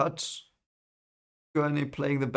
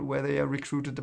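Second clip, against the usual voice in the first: ordinary talk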